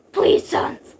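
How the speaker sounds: high, loud, strained plea; pleading. Acted. Father begging his son for his life, pleading. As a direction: beg with a rough, urgent voice. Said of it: "he pleaded".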